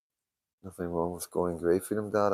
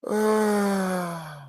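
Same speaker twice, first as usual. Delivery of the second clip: yawn; 13 semitones higher than usual. Real, not acted. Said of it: "I'm starting to yawn".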